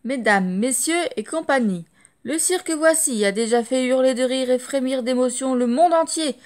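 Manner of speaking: loud, grand circus barker announcement; booming showman's welcome; solemn ringmaster call. Acted. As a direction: proclaim it like a ringmaster to the crowd. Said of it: "lancer son appel solennel".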